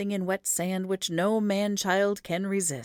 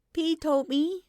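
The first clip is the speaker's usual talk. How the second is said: put-on child voice; 11 semitones higher, slower than usual. A narrator's reading. Child requests carrying.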